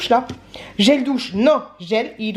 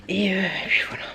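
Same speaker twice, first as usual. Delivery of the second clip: whisper; 3 semitones lower, 6 dB quieter than usual. Real, not acted. Trailing off after disappointment.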